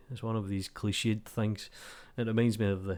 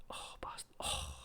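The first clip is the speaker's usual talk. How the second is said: breathy whispered swear at the game; whispered curse; 15 dB quieter than usual. Real, not acted. Gamer missing a shot while recording, whispered curse. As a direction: curse the missed shot under your breath.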